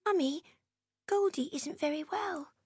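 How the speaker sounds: put-on high little girl's voice, worried; a small girl bringing bad news; worried child. A narrator's reading. Jenny tells her mother about the goldfish, worried child. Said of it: "with a worried frown".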